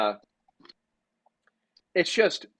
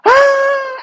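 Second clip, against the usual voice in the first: high, loud shrill scream; mock scream of a terrified kid; 12 dB louder than usual. Kid fleeing the dog, high loud scream. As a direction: shriek like a terrified boy. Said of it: "This kid was screaming".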